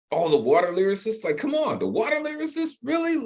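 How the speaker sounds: amused, high-pitched disbelief; tickled incredulity. Real, not acted. Host tickled by wordplay.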